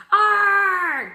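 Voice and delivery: very loud high roaring shout; monster roar. A narrator's reading. Jack and Annie roaring at the hyenas. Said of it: "they shouted".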